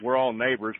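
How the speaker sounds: ordinary talk, nothing marked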